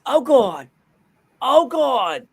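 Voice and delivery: high-pitched, queasy cartoon wail; hammed-up nauseated exclamations; Family Guy character. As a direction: moan like a cartoon man about to be sick.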